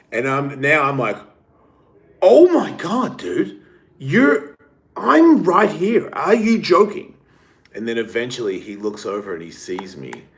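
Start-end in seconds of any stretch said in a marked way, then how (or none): 2.2-7.1 much higher, louder incredulous cry; retold indignant outburst; I'm right here, slowly and loud; mock outraged disbelief. Retelling her outraged reaction.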